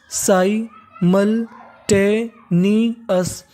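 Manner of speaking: Simultaneous stretched out very slowly, every syllable drawn long. Shown as a demonstration. Teacher modelling the whole word slowly for students. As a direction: spell simultaneous out in slow motion. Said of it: "तो पूरी meaning क्या बन गई हमारी?".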